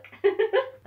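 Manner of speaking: high, loud goofy hyuck laugh. Retold or imitated. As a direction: bray out a silly cartoon laugh.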